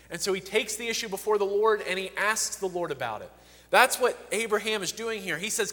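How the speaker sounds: usual speaking voice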